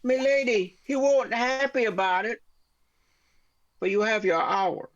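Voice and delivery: put-on high, hammy mangled southern-London accent; terrible accent. Messenger Johnny to his lady, in a hammed-up accent. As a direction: squeak out a bad southern accent mixed with London. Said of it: "That was a terrible accent".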